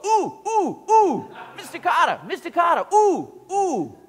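high, loud ooh ooh calling for the teacher; desperate to be picked; eager student. Retold or imitated. Horseshack straining to get Mister Cotter's attention, high and loud. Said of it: "raise his hand and go".

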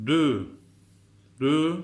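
"Dü" loud, lips pushed forward; dü sound. Shown as a demonstration. Teacher calling out "Dü" with rounded lips. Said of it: "dudağımız ileride olacak".

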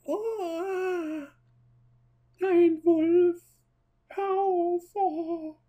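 very high squeaky falsetto cry; silly falsetto. Pretend. Drunk seeing a wolf.

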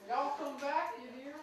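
hammed-up Mississippi goodbye; Mississippi accent. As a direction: wave them off in a light Mississippi accent. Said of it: "Mississippi accent".

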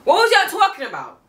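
high, loud incredulous demand. Acted. Fan scolding critics, high and incredulous. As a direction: call out the haters in disbelief. Repeating the question louder.

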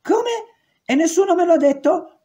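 high, outraged "Come?" and complaint; indignant outburst. Retold or imitated. Guard learns of execution, high indignant protest. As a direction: cry out high, offended nobody told her. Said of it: "esclamò indignata".

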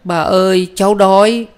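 very high, plaintive little voice; whimpering child. A narrator's reading. Small girl telling grandmother of hunger, whimpering high. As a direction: whine it like a hungry child. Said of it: "Cái hài đứng bên cũng xệu mẹo".

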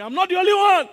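high, loud rebuke; loud preacher's rebuttal; loud retort. Real, not acted. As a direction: shout it back, firm.

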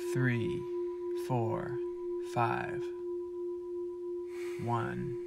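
quiet, steady counting of breaths; soft counting. Acted. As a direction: count each rep gently.

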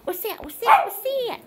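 high, quick startled questions; pretend alarm. Pretend alarm after the chant.

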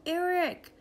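high, falling call; yelled out. A narrator's reading. George calls out for Eric, yelling. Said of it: "He yelled out".

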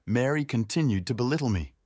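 usual speaking voice

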